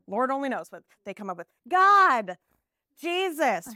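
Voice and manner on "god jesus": put-on child's wrong answer. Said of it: "We get a lot of that".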